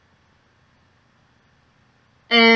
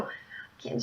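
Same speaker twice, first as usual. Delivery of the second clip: whisper; 18 dB quieter than usual. Retold or imitated. Shocked friend, retold gossip.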